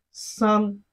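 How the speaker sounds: "San" on a high, level pitch; flat tone. Shown as a demonstration. Teacher models Chinese three for learners, flat-tone "San". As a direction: hold "San" steady with no fall. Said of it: "which is the flat tone".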